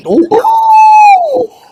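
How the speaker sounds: very high, loud shocked oh; hammed-up gasp of outrage; squealing fake horror; mock shocked wail. Mock outrage at racism joke.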